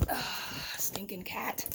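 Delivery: muttered exasperated groan at the cat; quiet, exasperated grumble under the breath. Real, not acted. Woman to the cat that knocked over her camera, exasperated.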